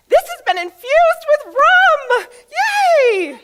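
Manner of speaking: high, loud hammed-up cheer; squealing mock party host; excited shout. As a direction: trumpet it like a proud host with a rum fruit bowl. Host cheerfully announcing rum fruit.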